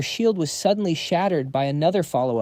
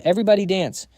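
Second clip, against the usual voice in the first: raised, higher rallying call; shouting; 5 semitones higher, 6 dB louder, faster than usual. A narrator's reading. Flem rallies the students into a dance, shouting. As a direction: call it out loudly to the whole group. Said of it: "Flem shouted to everyone".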